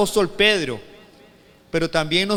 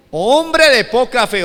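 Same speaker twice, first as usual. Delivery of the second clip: loud, raised, slow rebuke; exclamación; 5 semitones higher, 9 dB louder, slower than usual. Acted. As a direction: exclaim it slowly as Jesus reproaching Peter. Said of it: "otra vez en signos de admiración". (exclamación declamada, fuerte y lenta)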